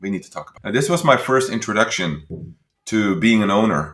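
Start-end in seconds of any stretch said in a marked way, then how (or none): 0.0-0.6 calming boss. Retold or imitated. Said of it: "my boss goes like".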